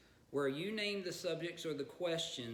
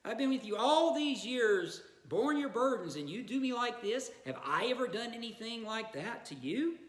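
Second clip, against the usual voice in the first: hurt donkey, pleading, higher and louder; the donkey complains to his master; talking donkey; 7 semitones higher, 3 dB louder than usual. Acted. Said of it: "The donkey turns and says".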